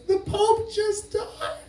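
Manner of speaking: high, soft, drawn-out tearful imitation; softly wailed mock grief; emotional mom. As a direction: imitate mom breaking sad news, slowly and high. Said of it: "My mom was kind of emotional".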